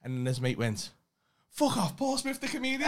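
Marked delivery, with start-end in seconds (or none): 1.5-2.9 high, loud mock outburst; acted-out shout of starstruck surprise; excited recognition. Lad bouncing over to a comedian he recognises, excited shout.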